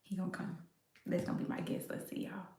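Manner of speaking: hushed whisper to viewers; soft whispered aside. Real, not acted. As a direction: whisper so dad won't hear. Hushed while calling dad.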